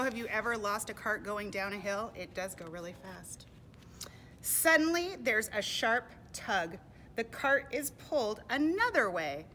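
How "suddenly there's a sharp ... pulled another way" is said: loud, suspenseful read-aloud; projected, emphatic picture-book reading; dramatic storybook narration. A narrator's reading. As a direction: announce a sudden twist to young listeners. Reads storybook to children.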